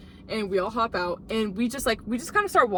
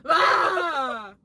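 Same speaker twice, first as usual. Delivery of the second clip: mock scream; 6 semitones higher, 4 dB louder than usual. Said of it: "he literally went".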